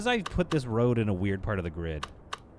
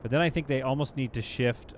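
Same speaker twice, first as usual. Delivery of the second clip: usual speaking voice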